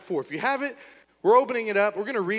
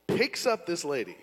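ordinary delivery

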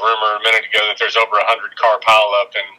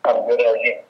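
muffled phone line. Real, not acted. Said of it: "It sounds like you're in a tunnel".

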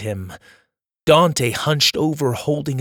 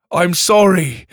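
raised, louder apology called down; shouted apology; 4 semitones higher, 5 dB louder than usual. Acted. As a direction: call down the apology with regret. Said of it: "he shouted back to him".

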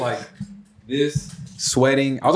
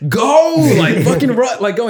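high, loud imitation of mom; urgent mock yell; 10 semitones higher, 7 dB louder than usual. Mom ordering her stalled son to run, high urgent shout. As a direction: yell it to send a kid running.

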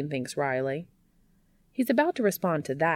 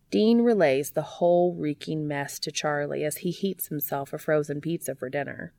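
ordinary delivery

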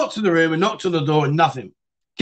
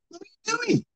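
high, startled question from under the duvet; baffled high squeak; 5 dB quieter than usual. Retold or imitated. Under the duvet, he asks her what's going on, baffled high squeak. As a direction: squeak it out, totally confused.

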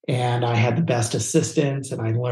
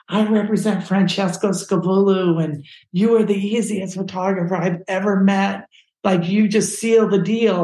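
higher, gushing agent's praise; enthusiastic praise; 7 semitones higher, 3 dB louder than usual. Retold or imitated. Agent telling the photographer clients love them, enthusiastic. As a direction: sell it with raised, eager emphasis.

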